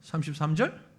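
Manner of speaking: ordinary talk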